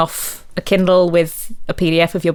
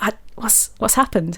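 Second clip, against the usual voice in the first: unvoiced whisper of alarm; hushed whisper; 3 semitones higher, 7 dB quieter than usual. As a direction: whisper it, startled. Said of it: "I'd be like".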